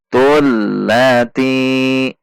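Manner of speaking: "Tul lati" with tu linked into a held L; drawn-out, connected "Tul lati"; joined reading. Shown as a demonstration. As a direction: recite "Tul lati" slowly, long final i. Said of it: "Disambung dari tu".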